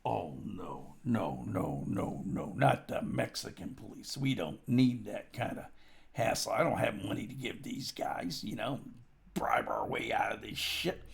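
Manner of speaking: low, soft, half-whispered pleading; hushed panicky plea. Retold or imitated. Storyteller to bartender, a low hushed plea. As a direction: hush him, begging not to call police. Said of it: "And I'm".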